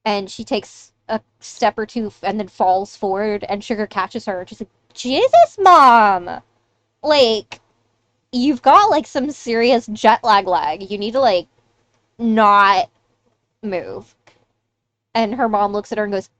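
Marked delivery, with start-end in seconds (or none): 4.9-14.1 exasperated scolding. Acted. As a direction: scold Mom in exasperation as she stumbles. Teen scolds stumbling mother.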